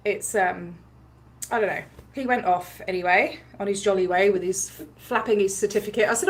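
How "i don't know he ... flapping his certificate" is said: normal talking voice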